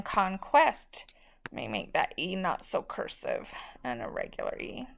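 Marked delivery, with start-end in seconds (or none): 1.5-4.9 soft whispered aside to herself. Real, not acted. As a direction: whisper it under your breath while writing. Teacher mutters to herself while writing.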